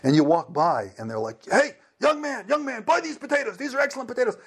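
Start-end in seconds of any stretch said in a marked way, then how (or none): 1.5-4.4 higher, hawking vendor's sales call; market vendor. Retold or imitated. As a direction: call out to a passing customer to sell potatoes. Market vendor calling to passersby.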